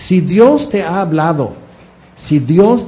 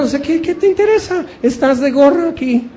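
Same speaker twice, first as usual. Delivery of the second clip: teasing jab; 9 semitones higher than usual. Real, not acted. Teasing jab at non-tither.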